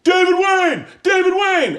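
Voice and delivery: shouting a name. Retold or imitated. Drunk at a party, yelling at a stranger, full shout. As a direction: yell it as loud as you can. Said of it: "As loud as I can".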